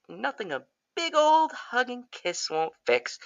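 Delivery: high, sugary creepy mom; lightly voiced eerie mother; creepy sweet mother. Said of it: "she's like".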